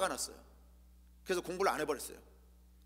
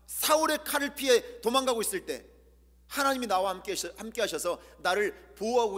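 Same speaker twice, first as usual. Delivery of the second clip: higher and louder fervent sermon; impassioned preaching; 6 semitones higher, 5 dB louder than usual. Acted. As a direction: pour it out to the congregation. Preaching at full force.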